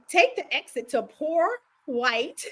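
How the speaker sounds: slow, measured GPS voice imitation; Siri. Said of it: "But she be like".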